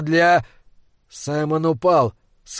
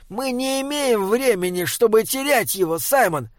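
high, urgent troll voice; sharp, high-pitched urging; sharp reproof; 6 semitones higher than usual. A narrator's reading. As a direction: scold him in a raised pitch. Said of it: "резко сказал тролль".

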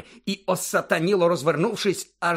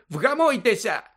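high, raised-voice order to calm down; sharp cry; 8 semitones higher, 4 dB louder than usual. A narrator's reading. Madam Pomfrey telling the professor off, crying out. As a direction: cry out a scolding command. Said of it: "крикнула мадам Помфрі".

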